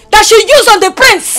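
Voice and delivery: screaming accusation, high and loud; furious shout. Acted. Mother accusing rival of bewitching the prince, furious shout. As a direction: yell it at her rival at the top of your voice.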